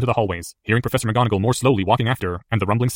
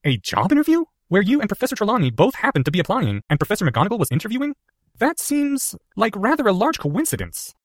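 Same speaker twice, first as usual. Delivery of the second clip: put-on much higher boyish voice, incredulous; suspicious young Harry; 11 semitones higher than usual. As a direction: sceptical schoolboy.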